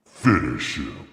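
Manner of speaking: slow, loud game announcer call; Mortal Kombat announcer. Pretend. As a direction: boom it out like a fight announcer. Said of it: "Mortal Kombat voice".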